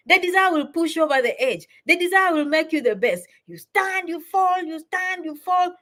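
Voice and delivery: high-pitched, chanting pep talk; rallying encouragement, pitched well up; motivational urging. Real, not acted. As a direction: urge them on with rising intensity.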